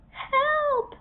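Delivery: high, thin cry for help from far away; tiny voice calling help. A narrator's reading. Tiny trapped hermit crab calling out for help. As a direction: call out small and high, desperate. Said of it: "said the voice".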